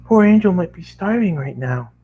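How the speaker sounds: high gentle worried pony voice; Fluttershy voice. A narrator's reading. Fluttershy worrying about hungry Angel, high and gentle. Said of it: "Fluttershy said".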